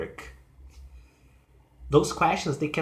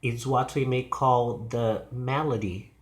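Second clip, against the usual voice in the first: ordinary delivery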